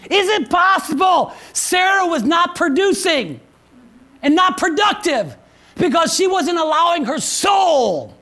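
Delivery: high, loud, slow shouted preaching; preaching at full force. Acted. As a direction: thunder the question at the congregation. Pastor builds to shouted climax.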